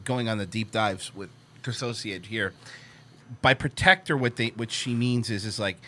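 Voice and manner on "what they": ordinary delivery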